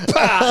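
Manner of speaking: a sharp laughing spit noise; spitting sound. Retold or imitated. Storyteller mimics the dad spitting diesel, laughing.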